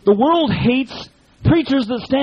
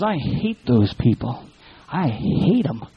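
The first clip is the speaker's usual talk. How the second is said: lower, slow hateful growl; 10 semitones lower, slower than usual. Retold or imitated. The world voicing its hatred.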